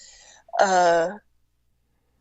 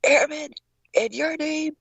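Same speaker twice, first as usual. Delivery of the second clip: drill instructor voice; 8 semitones higher than usual. Retold or imitated. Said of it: "he's like".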